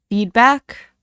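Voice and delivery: loud, incredulous snap; snapped. Acted. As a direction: snap the word back at him. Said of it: "James snapped".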